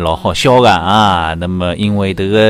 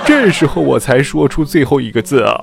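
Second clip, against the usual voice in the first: husband voice; 5 semitones higher than usual. Acted.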